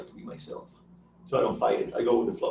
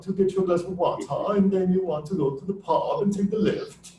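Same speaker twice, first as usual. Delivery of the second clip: put-on playful British accent, stiff upper lip; 5 semitones higher than usual. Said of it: "stiff upper lip".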